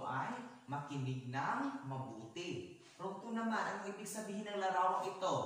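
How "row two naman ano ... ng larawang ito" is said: high, loud, fast question to a row; projected classroom question; calling out. Real, not acted. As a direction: call on a row of pupils briskly. Teacher calls on row two.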